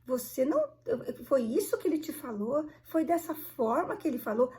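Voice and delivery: put-on shocked interrogation; incredulous questioning. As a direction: stretch the opening in disbelief, then press the questions. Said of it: "A entonação da pessoa distorce".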